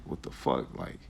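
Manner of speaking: low whisper, shaken and relieved; whispered disbelief. Retold or imitated. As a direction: let it out under your breath. Relief after police leave.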